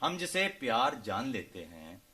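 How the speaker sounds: measured ghazal recitation, phrase by phrase; poetry recital. Acted. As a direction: recite couplets to an audience, unhurried and declaimed.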